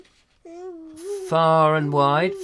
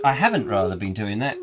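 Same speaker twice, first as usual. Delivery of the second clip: normal talking voice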